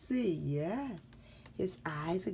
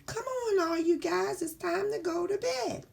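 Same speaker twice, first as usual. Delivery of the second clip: high-pitched call to gather the toys; calling out cheerfully; 11 semitones higher, 3 dB louder than usual. Acted. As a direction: round everyone up in a high voice. Invents the character's bedtime call.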